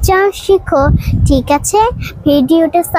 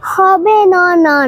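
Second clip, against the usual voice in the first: ordinary talk, nothing marked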